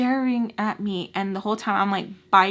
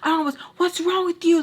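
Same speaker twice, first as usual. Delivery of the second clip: retold yell; 9 semitones higher, 4 dB louder than usual. His shocked reply in the fight.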